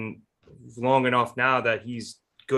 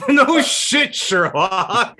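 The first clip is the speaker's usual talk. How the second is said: laughing; 9 semitones higher, 8 dB louder, much slower than usual. Real, not acted. Laughing retort after friend's admission.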